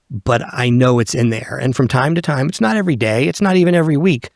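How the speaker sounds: usual speaking voice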